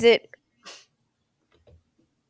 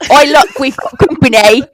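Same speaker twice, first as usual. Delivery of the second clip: louder mock British accent; 2 semitones higher, 12 dB louder than usual. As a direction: ham up a British rock star. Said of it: "in the perfect Aerosmith accent".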